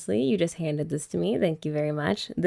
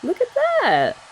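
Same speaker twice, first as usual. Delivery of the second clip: much higher, louder look at that; delighted; 14 semitones higher, 4 dB louder than usual. Real, not acted. Showing off the finished nape to the camera, delighted. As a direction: squeal it up high, thrilled.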